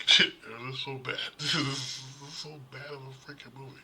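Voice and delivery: high-pitched, laughing through the words. Real, not acted. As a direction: crack up at how awful it is. Cracking up over terrible movie.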